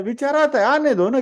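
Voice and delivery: high, lilting let-it-be tone; airy, high-pitched shrug; light dismissal. Acted. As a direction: brush it aside playfully. Waving off a passing thought.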